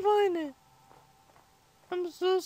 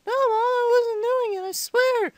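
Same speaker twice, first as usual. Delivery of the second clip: put-on higher kid voice pleading innocence; protesting child; 4 semitones higher than usual. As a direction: deny it to mom like a little kid. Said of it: "my mom heard again".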